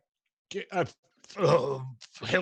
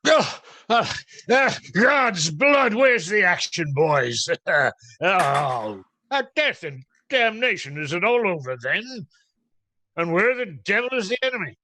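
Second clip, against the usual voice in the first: bellowing friar; 6 dB louder than usual. Acted. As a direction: storm in roaring, demanding the fight. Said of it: "over your bellows".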